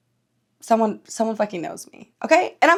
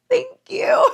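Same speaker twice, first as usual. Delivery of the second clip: tiny, very high-pitched thank-you; flattered squeal. Retold or imitated. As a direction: squeak it out, touched and giggly. Reacting to imagined compliment.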